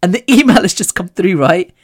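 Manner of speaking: ordinary talk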